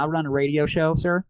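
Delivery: ordinary delivery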